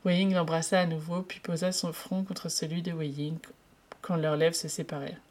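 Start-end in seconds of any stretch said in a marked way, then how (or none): none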